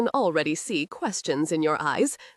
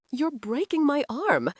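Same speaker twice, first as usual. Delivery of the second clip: higher, pained complaint; pained cry; 7 semitones higher than usual. A narrator's reading. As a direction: whine through the pain. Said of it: "screamed when Gray pulled his arm".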